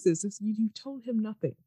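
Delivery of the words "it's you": ordinary talk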